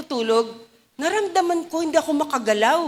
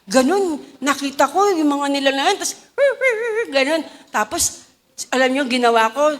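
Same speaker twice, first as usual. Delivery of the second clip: ordinary talk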